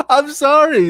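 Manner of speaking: high, loud sorry after a laugh; amused apology. Real, not acted. Host apologizing for laughing at the actress, amused.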